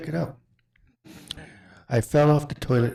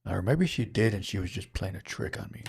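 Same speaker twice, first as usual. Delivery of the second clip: ordinary delivery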